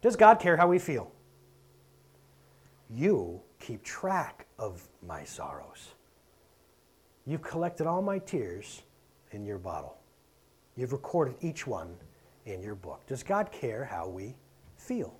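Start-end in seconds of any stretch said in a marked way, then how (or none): 2.9-13.0 slow, soft, low psalm reading; scripture reading. Acted. Preacher reading a psalm to the congregation, slow and soft. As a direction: read it hushed and measured, pausing between phrases.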